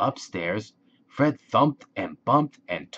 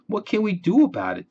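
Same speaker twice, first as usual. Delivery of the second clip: high, fast fretting question; anxious high question; 10 semitones higher, 4 dB louder, much faster than usual. A narrator's reading. Fred asks Ted for help, high anxious rush.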